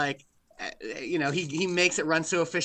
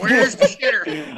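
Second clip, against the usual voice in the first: loud, slow, higher mock holler; imitated hollering; 4 semitones higher, 6 dB louder, slower than usual. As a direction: call it out crudely, drawing it out. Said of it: "going like".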